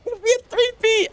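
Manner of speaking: very high, silly squeal; playful falsetto hype; squealed mock hype. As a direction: squeak it out in joking excitement. Joking hype about winning thrice.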